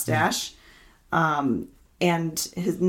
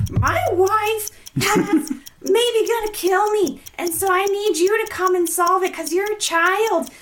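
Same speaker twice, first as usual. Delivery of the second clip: excited high rush; 12 semitones higher, 3 dB louder than usual. Real, not acted. Frantic rushed book summary challenge.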